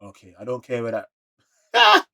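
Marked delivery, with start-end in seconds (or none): 1.7-2.1 sudden shout. Real, not acted. Shout heard.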